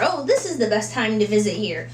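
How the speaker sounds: usual speaking voice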